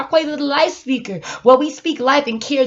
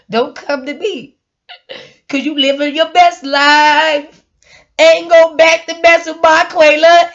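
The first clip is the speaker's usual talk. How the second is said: ordinary talk, nothing marked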